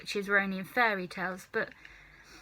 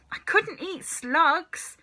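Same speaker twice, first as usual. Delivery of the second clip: put-on higher, slow horrified child; disgusted boy; 8 semitones higher, 3 dB louder, slower than usual. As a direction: whine in horror. George disgusted.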